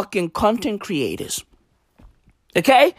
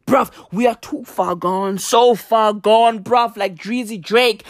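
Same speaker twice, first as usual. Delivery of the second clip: normal talking voice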